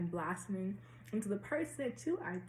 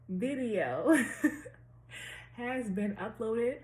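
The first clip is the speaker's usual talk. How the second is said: laughing; 3 semitones higher, 4 dB louder, much slower than usual. Real, not acted. Laughs mid-sentence.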